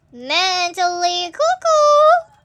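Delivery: drawn-out singsong cry, jumping high and loud; mocking exclamation. Real, not acted. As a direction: stretch it slowly and leap up at the end. Mocking the note's writer.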